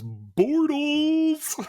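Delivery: high, loud, long-held cry; drawn-out holler. Pretend. Host announces the fan nickname, long high holler. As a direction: belt out the silly name and hold it.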